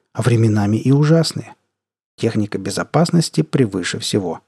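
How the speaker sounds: plain reading voice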